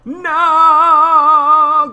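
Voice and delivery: long high scream of dismay; despairing scream. Real, not acted. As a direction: wail no in despair. Game setback.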